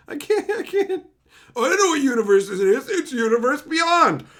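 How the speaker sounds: high, loud laughing through words. Real, not acted. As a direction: crack up mid-sentence. Breaks into laughter.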